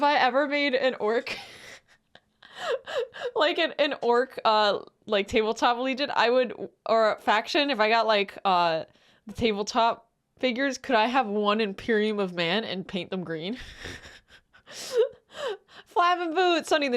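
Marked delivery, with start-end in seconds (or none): none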